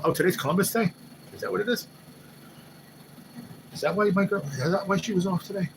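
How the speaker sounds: high, surprised double take; sudden realization. Real, not acted. Realizing it is Columbus Day and why his girl was off, surprised. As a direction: catch yourself mid goodbye, amazed. Realizes holiday mid-sign-off.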